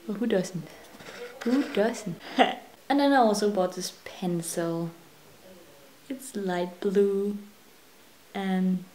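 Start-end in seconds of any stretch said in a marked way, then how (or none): none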